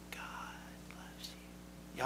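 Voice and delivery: very soft, hushed whisper. Shown as a demonstration. Leading children in telling the congregation, whispered. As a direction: whisper the message on the count. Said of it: "we're gonna whisper that message to them".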